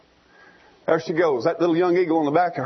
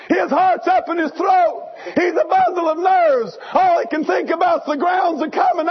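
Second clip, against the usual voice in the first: high, loud dramatic telling; dramatic preaching; 10 semitones higher, 3 dB louder than usual. Acted. Preacher acting out the eaglet's fall, dramatic and loud. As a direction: tell the fall with rising alarm.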